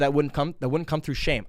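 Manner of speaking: ordinary talk, nothing marked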